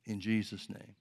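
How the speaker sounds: earnest praying aloud to God; fervent prayer. Acted. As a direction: pray it with conviction. Prayer continues.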